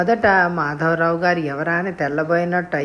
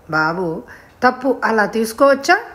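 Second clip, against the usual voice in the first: mildly cross, sharper and louder on the question; a bit angry; 4 semitones higher, 3 dB louder than usual. A narrator's reading. Mother scolding her son for taking gifts, mildly angry. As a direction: scold the boy with mild anger. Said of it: "అంది కాస్త కోపంగా".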